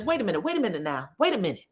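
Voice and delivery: fast, slightly hushed cutting in; rushed interruption. Retold or imitated. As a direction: hurry to cut her off. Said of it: "Cecil says".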